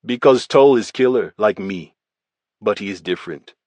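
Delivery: a hint of a Russian accent, blunt and even; lightly voiced Russian gangster, matter-of-fact; Russian gangster giving his statement, lightly accented. Volkov's recorded statement.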